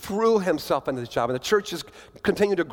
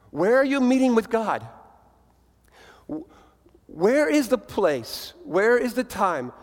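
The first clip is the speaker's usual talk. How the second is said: full-force preaching; 4 semitones higher than usual. Acted. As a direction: press the question home to the congregation. Challenges the congregation.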